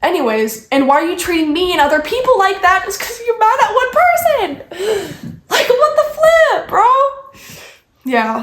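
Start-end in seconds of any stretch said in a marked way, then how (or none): 0.7-6.9 high indignant outburst climbing higher; exasperated rant. Real, not acted. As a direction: build to an incredulous, squeaky protest. Rant at absent friend peaks.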